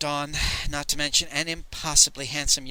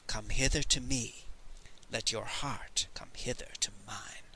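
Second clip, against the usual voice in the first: whispered charm; 5 semitones lower, 9 dB quieter than usual. Acted. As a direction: chant sinking into a whisper. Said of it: "the song charm was repeated".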